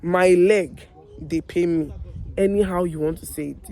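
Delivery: ordinary delivery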